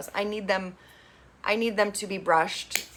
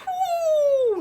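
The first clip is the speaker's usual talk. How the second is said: mock whew; 4 dB louder than usual. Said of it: "So everybody's like".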